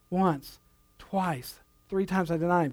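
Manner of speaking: a soft, heavy murmur of remorse, imitating Peter; softly voiced guilt. Preacher imagining Peter waking to his guilt, softly. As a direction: let Peter's counting sink down quietly.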